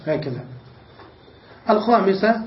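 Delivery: ordinary talk, nothing marked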